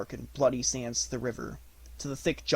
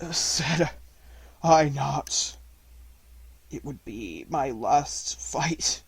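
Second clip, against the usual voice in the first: gasping; much slower than usual. A narrator's reading. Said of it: "Akela gasped".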